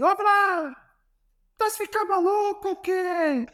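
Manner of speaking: high-pitched mock coach shout with a Portuguese accent; Jorge Jesus impression. Coach yelling at his player in training, high mock Portuguese voice. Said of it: "ele grita assim".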